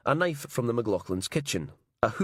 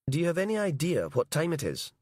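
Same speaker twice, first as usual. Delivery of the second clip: higher, sharp woman's voice on the phone; irritated night receptionist; 8 semitones higher than usual. Acted. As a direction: scold the late caller from a higher register.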